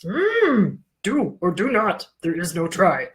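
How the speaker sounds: a lightly voiced Yoda, guttural hum first; a quick, half-hearted Yoda; mild imitation of a wise old alien master; Yoda impression. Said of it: "my Yoda impersonation".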